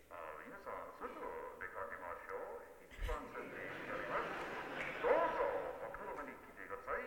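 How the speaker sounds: lightly voiced Japanese station announcement; Japanese train conductor voice. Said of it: "Japanese train conductor voice".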